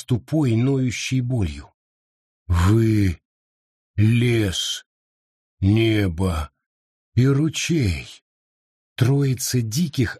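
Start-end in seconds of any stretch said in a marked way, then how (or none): 2.5-8.2 dazed low beast voice. Acted. Father names them, dazed.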